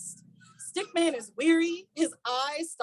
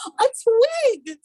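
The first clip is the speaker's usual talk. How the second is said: higher, pleased swan; 8 semitones higher, 8 dB louder than usual. A narrator's reading. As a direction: announce the find proudly. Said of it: "says the swan".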